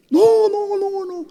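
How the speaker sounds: put-on very high, frightened child's no; panicked little girl. Scared girl refuses the pastor's prophecy, high and panicked.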